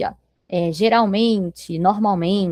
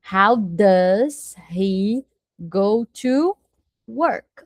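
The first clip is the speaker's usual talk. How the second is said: slow dictation; much slower than usual. Shown as a demonstration. Teacher spells out a question.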